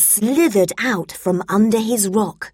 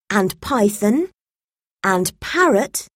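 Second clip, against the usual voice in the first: ordinary delivery, nothing marked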